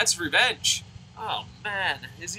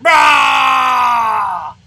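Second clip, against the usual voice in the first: long, full-blast roaring growl; very loud growl; 7 semitones higher, 15 dB louder than usual. Acted. Man growling back at old people, huge mock roar. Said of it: "growl as loud as I can".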